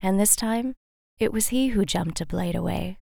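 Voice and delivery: ordinary delivery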